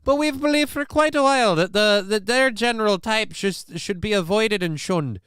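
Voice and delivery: high, loud mock Irish brogue; put-on Irish accent. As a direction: play a Scottish local whose accent slides into Irish. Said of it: "I don't know why I'm doing more of an Irish voice now".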